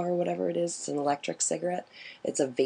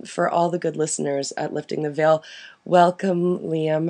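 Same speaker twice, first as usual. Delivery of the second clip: ordinary talk, nothing marked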